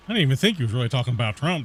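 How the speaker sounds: ordinary delivery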